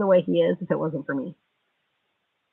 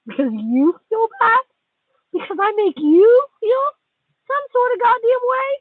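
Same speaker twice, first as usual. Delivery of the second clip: rising much higher into a sneering, mocking question; sarcastic mockery; 4 dB louder than usual. Real, not acted. To the friend who feels bad, high scornful mocking. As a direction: throw their feelings back at them with a scornful lilt.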